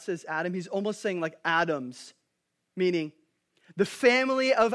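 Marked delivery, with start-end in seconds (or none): none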